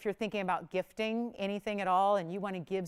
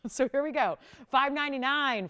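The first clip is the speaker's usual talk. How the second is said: ordinary delivery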